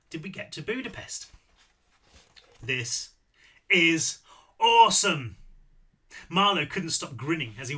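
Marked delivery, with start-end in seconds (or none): 2.6-5.4 gleeful shout. Acted. Marlowe crowing to himself as he runs, exuberant yell. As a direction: shout it with pure delight at running. Marlowe thrilled by his speed.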